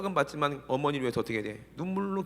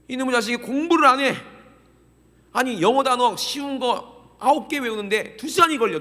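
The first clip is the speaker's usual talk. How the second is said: loud, high mock rant of a fed-up parent; exasperated parent; 8 semitones higher, 9 dB louder than usual. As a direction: vent like a parent at wit's end. Parent fuming over son's studying. (속 터진 부모처럼 높고 크게 하소연)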